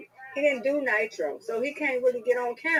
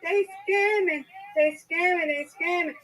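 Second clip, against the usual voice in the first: mock-panicked friend, higher, looping "they scamming"; 6 semitones higher, slower than usual. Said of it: "He's like".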